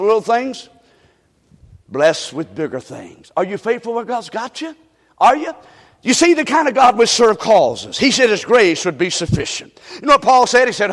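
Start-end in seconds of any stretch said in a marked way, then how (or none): none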